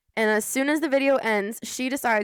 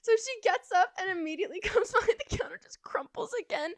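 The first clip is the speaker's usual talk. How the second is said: whispered laugh; 5 semitones higher, 7 dB quieter than usual. Real, not acted. Laughing narration of fall.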